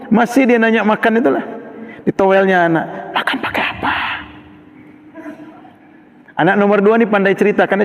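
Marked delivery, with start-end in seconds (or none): 3.1-3.9 soft whispered motherly question. Retold or imitated. Mother nudging her son at the boarding school, whispering. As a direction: whisper it to the child. Mother asks child softly.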